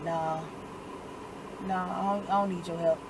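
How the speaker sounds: quiet, low-pitched brush-off; low refusal. Retold or imitated. Retold Tommy refusing family's help, low and quiet. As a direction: turn the offer down softly, pitched low.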